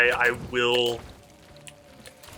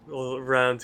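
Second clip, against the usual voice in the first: ordinary talk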